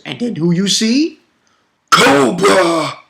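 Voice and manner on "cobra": loud, high drawn-out cheer; hammy battle cry. Pretend. Fan announcing Cobra's arrival, hammy battle cry. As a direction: bellow the name like a hype man. Excitedly hails character's entrance.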